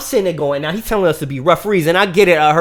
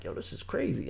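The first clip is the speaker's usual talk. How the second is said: barely voiced, under his breath; hushed aside; 3 semitones lower, 16 dB quieter than usual. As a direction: mutter it so nobody in class hears. Muttering to himself in class.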